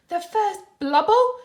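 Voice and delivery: high, loud rising question; excited slip. A narrator's reading. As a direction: blurt it in wonder. Patrick misspeaks, excited.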